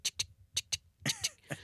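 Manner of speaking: quick soft tongue-click ticks; imitated squeezing clicks. Trainee with the stress ball, imitated soft ticking. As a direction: tick away under your breath.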